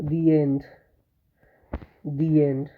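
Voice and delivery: "the" said "दी" before end; short "दी" before the vowel; the as "thee". Shown as a demonstration. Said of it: "दी होता है".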